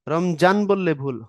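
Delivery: usual speaking voice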